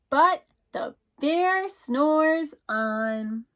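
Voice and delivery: drawn-out, very slow refrain, leaning on bear. Acted. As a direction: stretch every word of the refrain. Refrain in picture book.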